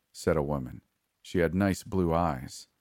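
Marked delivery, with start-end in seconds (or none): none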